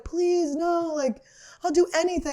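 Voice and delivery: a hint of mom pleading; imitating mom pleading. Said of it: "My mom was like".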